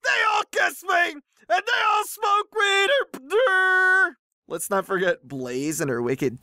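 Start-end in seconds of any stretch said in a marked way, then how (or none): 0.0-4.1 very high, squealing mock yell; mocking tantrum shout. Reader mocking the poster's reminders about his girlfriends, squealing imitation. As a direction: parody him bragging at full squeal. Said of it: "Love how he keeps reminding us that".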